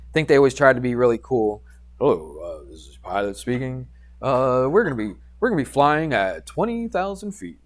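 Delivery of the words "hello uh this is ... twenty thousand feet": pilot voice. Retold or imitated. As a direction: play a calm, cool captain talking to the cabin. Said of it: "in his pilot voice".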